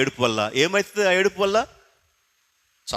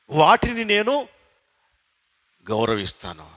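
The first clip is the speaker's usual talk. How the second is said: normal talking voice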